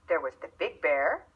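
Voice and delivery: usual speaking voice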